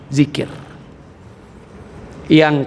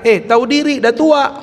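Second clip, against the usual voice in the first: higher, scoffing put-down; mocking angel; 7 semitones higher than usual. Angel scolding an old man, mock scoffing higher. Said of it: "apa kata malaikat".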